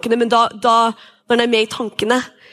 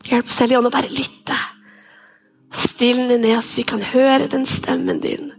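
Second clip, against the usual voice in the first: worship prayer. Acted. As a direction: lead the room in prayer. Leads the congregation in prayer. (inderlig forbønn)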